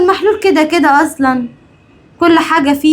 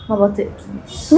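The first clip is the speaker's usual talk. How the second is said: much softer, low menace; quiet venomous threat; menacing hiss; 5 semitones lower, 9 dB quieter than usual. Acted. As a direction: drop the voice to a threat. Villainous threat to mother-in-law.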